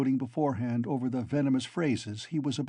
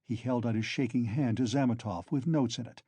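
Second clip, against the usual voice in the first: usual speaking voice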